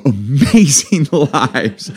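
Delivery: high, slow, gasping mock wonder; hammed-up envious gasp; mock awe. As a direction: gasp over their amazing lives. Mocking envy of others' lives.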